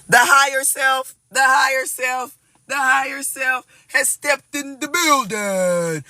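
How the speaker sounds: high, slow, drawn-out announcer call; grand announcement. Acted. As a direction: announce it like an arena entrance.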